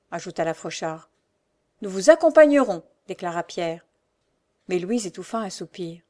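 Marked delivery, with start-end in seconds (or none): 1.8-2.8 loud, higher, keen offer; volunteer with raised voice; eager helper. A narrator's reading. Pierre offers to go along.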